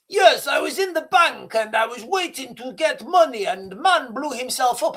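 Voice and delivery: mock a flustered colleague in broken English. Colleague blurts out the bank news to his boss in broken English. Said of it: "It was broken English".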